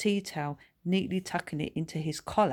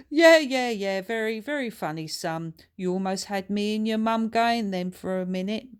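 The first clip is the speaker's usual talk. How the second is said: amused, louder mocking father; laughing dad; 5 semitones higher, 5 dB louder than usual. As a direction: laugh it off as a prank. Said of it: "Laughing out loud".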